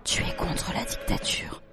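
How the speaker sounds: slow, secretive whisper; murmured in the ear. A narrator's reading. Pedro to Juan in class, secretive whisper. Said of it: "lui murmura à l'oreille".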